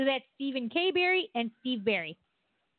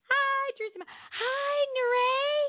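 high sing-song greeting; 13 semitones higher than usual. Acted. High playful greetings to viewers.

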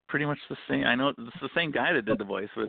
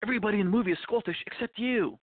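higher, eager director voice; pushy film director; 9 semitones higher than usual. Retold or imitated. Guest mocks a director briefing Sean Connery, high eager voice. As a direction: pitch the movie to him like a keen director. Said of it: "the director comes to him and goes".

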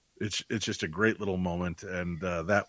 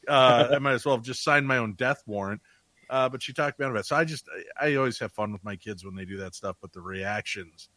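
usual speaking voice

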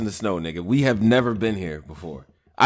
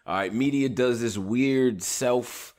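ordinary talk, nothing marked

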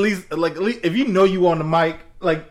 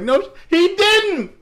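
high, drawn-out mock protest; indignant retort; 13 semitones higher, slower than usual. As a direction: contradict her in a high, incredulous voice. Retold argument with startled mom.

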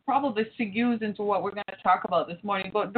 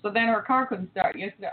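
normal talking voice

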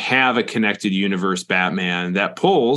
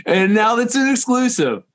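triumphant announcer; 12 semitones higher than usual. Pretend.

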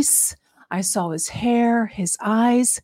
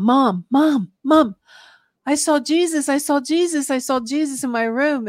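higher, urgent calls, re-enacted; retold tearful outcry; 5 semitones higher than usual. Teen telling mom about a vision, retold urgent outcry. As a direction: re-enact a frantic teen calling out, without real sobs. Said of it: "And, and I was bawling and crying".

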